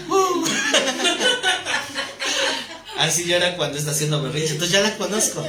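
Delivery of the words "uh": a high, loud siren "uh" breaking into laughter; siren wail imitation. Shown as a demonstration. Pastor imitating daughter's tantrum as earthquake alarm siren. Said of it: "alerta sísmica".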